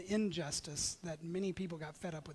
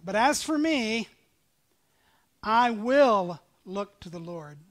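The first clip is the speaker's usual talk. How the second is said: slow, louder reading of the verse; scripture reading; 2 semitones higher, 8 dB louder, slower than usual. Acted. Preacher reading Micah to the congregation, slow and loud. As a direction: proclaim the prophet's words slowly.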